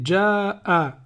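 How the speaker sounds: ja'a stretched slowly; slow long vowel. Shown as a demonstration. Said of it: "ça fait".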